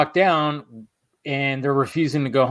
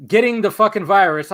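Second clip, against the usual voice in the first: ordinary talk, nothing marked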